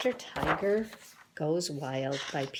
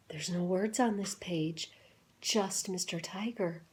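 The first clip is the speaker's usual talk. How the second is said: ordinary delivery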